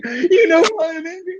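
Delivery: put-on high, whiny angry sob of a ten-year-old; angry crying kid. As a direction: wail it like a boy who just lost the game. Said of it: "So now I'm angry crying".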